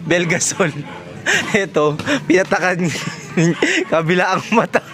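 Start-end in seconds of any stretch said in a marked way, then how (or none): 1.6-4.8 laughing while talking. Real, not acted. Teasing a friend over drops in both eyes, laughing. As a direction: barely get it out through laughter. Laughing at the eye-drop mix-up.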